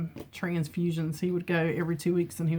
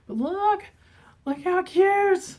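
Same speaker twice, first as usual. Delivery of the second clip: much higher, slow gushing over something cute; high squeal of delight, stretched out; 12 semitones higher, 5 dB louder, slower than usual. Real, not acted. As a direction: coo over something adorable. Gushing over cute hand towels.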